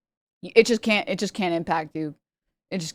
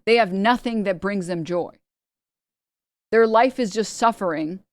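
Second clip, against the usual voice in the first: ordinary delivery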